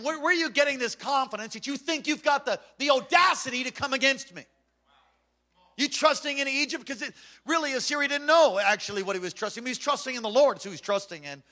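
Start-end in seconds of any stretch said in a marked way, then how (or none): 0.0-6.9 taunting Assyrian king. Acted. Preacher voicing the taunting Assyrian.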